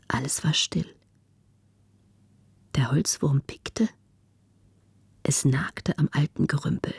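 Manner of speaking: hushed, suspenseful storytelling with long pauses; whispered narration. A narrator's reading. Narrator as something scurries in the old hall, whispering. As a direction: whisper the eerie stillness of the room.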